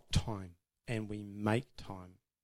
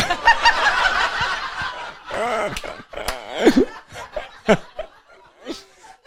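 long, high, loud laughing fit; big breathy belly laugh; real hearty laughter; 12 semitones higher, 11 dB louder than usual. Real, not acted. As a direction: crack up helplessly. Speaker cracks up after his remark.

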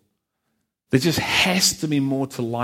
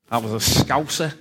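Scouse accent. Shown as a demonstration. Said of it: "talk with a funny twang like that".

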